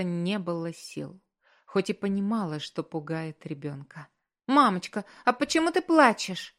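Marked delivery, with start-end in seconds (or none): 4.5-6.5 put-on high, childlike worried question; frightened little boy. A narrator's reading. Little son asks his crying mother why, in a frightened babble. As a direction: lift the voice into a small child's anxious babble. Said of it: "Испуганно пролепетал Мэтти".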